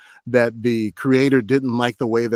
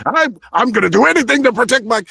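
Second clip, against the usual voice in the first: loud, high, rushed declaration; overplayed resolve; 11 semitones higher, 7 dB louder, faster than usual. Shown as a demonstration. Actor showing the overdone take of a father protecting his kids, loud and high. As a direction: belt it out too big and fast. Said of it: "If I could be like".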